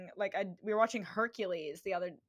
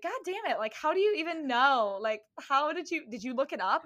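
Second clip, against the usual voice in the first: mock frustrated friend; 6 semitones higher, 4 dB louder than usual. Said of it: "she'd be like".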